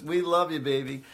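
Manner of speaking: ordinary talk, nothing marked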